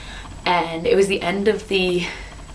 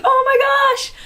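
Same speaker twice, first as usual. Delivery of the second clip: much higher and louder gasping shriek; mom's shriek; 6 dB louder than usual. Retold or imitated. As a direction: mom overjoyed at the news. Mom reacts on speakerphone.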